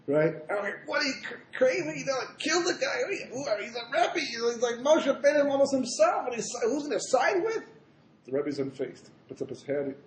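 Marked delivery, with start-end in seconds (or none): 0.9-7.6 much higher, mock indignation; outraged followers. Chassidim shocked at the question to their Rebbe, high indignation. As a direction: play scandalised disciples protesting.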